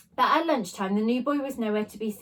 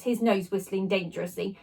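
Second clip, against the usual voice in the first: ordinary delivery, nothing marked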